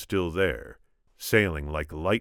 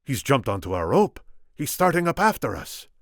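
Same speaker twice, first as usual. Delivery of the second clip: high, loud character voice; urgent lookout report as the Spaniard; 7 semitones higher, 5 dB louder than usual. A narrator's reading. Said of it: "the Spaniard said".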